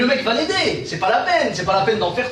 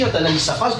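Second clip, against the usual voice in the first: ordinary delivery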